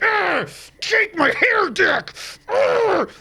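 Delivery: high hammed-up pirate yell, drawn out; mock pirate growl. Mocking the hero as he rides the giant bird, hammed-up pirate yell.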